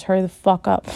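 loud impatient command; sudden loud dominant shout; loud order. Acted. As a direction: bark at her to hurry. Dom barking an order.

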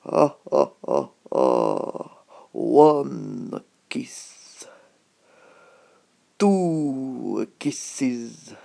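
loud hammed-up passionate kisses; Addams Family romantic. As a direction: ham up a lovesick gentleman. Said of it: "how did he go again?".

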